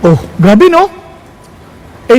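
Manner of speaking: ordinary talk, nothing marked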